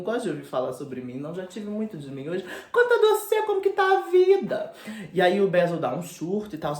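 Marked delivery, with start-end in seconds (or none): 2.7-4.7 much higher, louder breezy question; campy Dorian. Retold or imitated. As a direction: brush him off in a high, bright put-on voice. Dorian changing subject campily.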